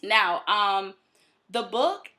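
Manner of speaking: usual speaking voice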